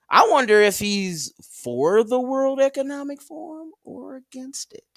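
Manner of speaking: lightly put-on high, odd voice; hint of a silly voice. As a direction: muse in a goofy put-on tone. Goofy voice musing about Elon.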